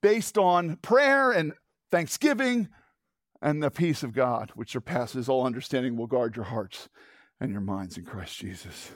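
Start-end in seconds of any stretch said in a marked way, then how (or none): 3.4-8.9 dropping low and soft into blessing; soft benediction. Acted. Pastor blessing the congregation, soft and hushed. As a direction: bless them gently, fading away.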